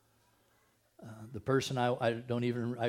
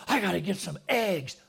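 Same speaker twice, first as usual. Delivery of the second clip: frantic errand; 6 semitones higher, 5 dB louder than usual. Acted. Acting hurried shopper driving fast.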